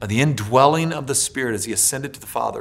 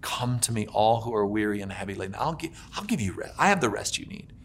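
much softer, gentle call; Jesus quietly calling the weary to him; tender invitation; 4 semitones lower, 12 dB quieter than usual. Acted. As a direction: draw them in close and soft. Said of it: "where he says".

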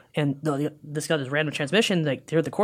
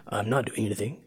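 low, soft put-on macho voice; mock-deep denial; 6 semitones lower, 8 dB quieter than usual. Scolded captain insisting innocence, mock deep. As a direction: deny it in a lowered fake voice. [name] denies copying, still deep voice.

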